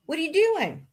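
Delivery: high question that drops at the end; playful pestering. Pretend. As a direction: tease with a high, falling question. Playfully pestering someone off mic.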